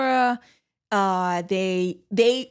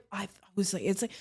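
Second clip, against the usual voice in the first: usual speaking voice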